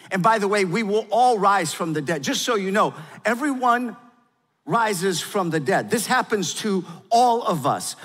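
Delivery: loud, high, impassioned preaching voice; preaching at full force. Acted. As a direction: proclaim it to the whole room.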